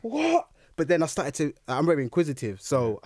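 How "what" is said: very high-pitched mock-bewildered question; incredulous. Replaying his thoughts about reptiles on the train, incredulous. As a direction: squeak it out in retold disbelief.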